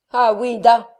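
loud, menacing sardonic jeer. A narrator's reading. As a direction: cut in with frightening sarcasm. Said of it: "son accent sardonique avait quelque chose d'effrayant". (sardonique et menaçant, d'une voix forte)

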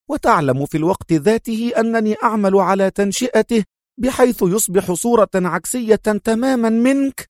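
high, gloating hateful tirade; full of loathing. A narrator's reading. Sonia relishes Adham's torment over his son, loathing. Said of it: "تابعت هي بنفس اللهجة".

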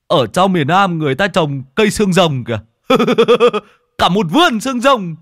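high, loud shouts broken by cackles; amazed laughing. Acted. Gang member pointing out a cactus field, loud and laughing. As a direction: crow it out, bursting into laughter.

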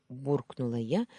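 plain reading voice

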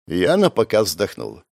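ordinary delivery, nothing marked

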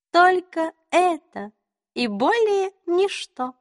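high, slow, teasing queen; high regal faerie queen. A narrator's reading. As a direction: sweetly toy with him. Queen voiced in the story.

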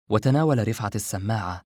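usual speaking voice

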